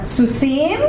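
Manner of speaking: ordinary talk, nothing marked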